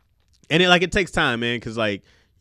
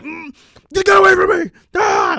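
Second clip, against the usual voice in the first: imitating his old explosive shout, much higher and louder; exploding yell; 11 dB louder than usual. His younger self blowing up at someone, imitated angry shout. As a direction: re-enact blowing up at someone, hammed-up. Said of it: "Before I had one of those exploding ones".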